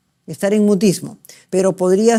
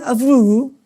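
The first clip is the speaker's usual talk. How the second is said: nonsense syllables with normal tune, in a changed voice; patient voice answering the doctor in fluent gibberish; fluent aphasic babble; 3 semitones higher, 3 dB louder than usual. Shown as a demonstration. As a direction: babble like a Wernicke patient who thinks she's talking. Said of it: "¿Y algo le duele?".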